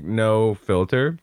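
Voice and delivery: usual speaking voice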